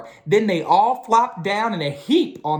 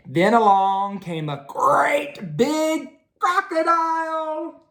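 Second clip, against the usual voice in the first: dramatic storytelling; 10 semitones higher, slower than usual. A narrator's reading.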